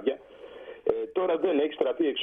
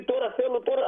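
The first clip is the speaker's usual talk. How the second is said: higher, insistent mock yell of the young sailor; retold demanding shout; 10 semitones higher than usual. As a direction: replay his demand at a raised pitch. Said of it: "άρχισε να φωνάζει".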